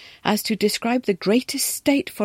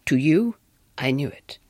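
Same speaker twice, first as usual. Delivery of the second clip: low questioning wife; 5 semitones lower than usual. A narrator's reading. The wife turning the question on the governess, low.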